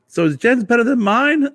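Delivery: high, loud jealous stepmom voice; stepmom asking. Retold or imitated. Stepmom pressing him about whose dish is better, high and loud. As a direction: mimic her fishing for praise, way up high. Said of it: "she's like".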